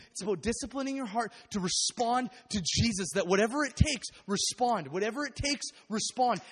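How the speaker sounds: loud building exhortation; impassioned preaching. Acted. Preacher urging the congregation to respond, loud and building. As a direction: drive the point home to the congregation.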